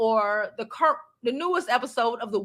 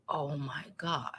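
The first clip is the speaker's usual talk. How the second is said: low, hushed mortified murmur; quietly mortified, almost whispered; embarrassed aside; 8 semitones lower, 13 dB quieter than usual. Retold or imitated. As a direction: say it under your breath, recalling embarrassment. Recalls her embarrassed private reaction.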